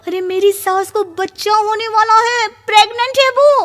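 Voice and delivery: high, excited rush of news; excited blurt. Acted. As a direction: spill the shocking gossip breathlessly. Bahu blurts shocking news to mother. (उत्साह से ऊँची आवाज़ में खबर सुनाना)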